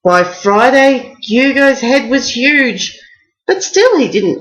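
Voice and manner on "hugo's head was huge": ordinary delivery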